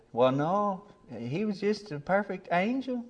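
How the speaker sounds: high-pitched imitation of an adoring grandmother; sweetly insist, playing the fond granny; doting grandma. Grandma defending her beloved grandson.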